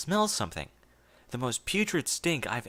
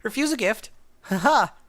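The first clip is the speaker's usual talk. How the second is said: higher and louder, a brittle fake laugh; forced cheer; 8 semitones higher, 7 dB louder than usual. A narrator's reading. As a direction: overbright denial hiding terror.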